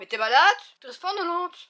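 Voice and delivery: loud playful protest; mock outraged shout. Mother protests at daughter staying abroad.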